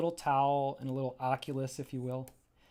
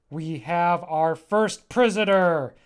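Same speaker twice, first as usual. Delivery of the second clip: slow, louder proud announcement; proud captor; 3 semitones higher, 9 dB louder, slower than usual. Acted. Pillow creature boasting to its troop, slow and loud. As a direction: crow about the catch.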